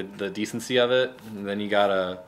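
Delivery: ordinary delivery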